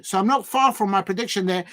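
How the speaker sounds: ordinary delivery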